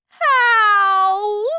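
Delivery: high, loud, wailing question; distraught mother. A narrator's reading. Mother asking how to enlarge her son, wailing. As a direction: wail the word in despair.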